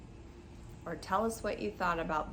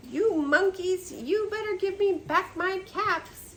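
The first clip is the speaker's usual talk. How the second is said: quite angry peddler; 9 semitones higher, 6 dB louder than usual. Acted. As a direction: threaten the monkeys, losing patience. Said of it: "Now he felt quite angry".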